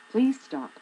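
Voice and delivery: loud, high pleading please stop; exasperated plea, punching please; emphatic please stop. Real, not acted. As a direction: beg them to stop, hard.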